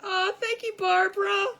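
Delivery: high, loud delighted thank-you. Real, not acted. Thanking a viewer for her kind comment, delighted. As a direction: gush your thanks with a little cry of joy.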